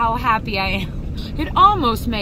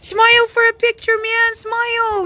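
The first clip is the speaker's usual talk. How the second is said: coaxing pet voice; 10 semitones higher, 4 dB louder than usual. Real, not acted. Coaxing the cat for a photo.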